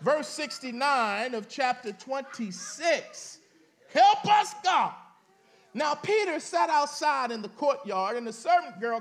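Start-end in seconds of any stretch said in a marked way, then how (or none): 3.9-4.9 a loud, high cry for help; shouted plea. Real, not acted. Preacher crying out to God mid-sermon, loud shout. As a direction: call out to God with all you have.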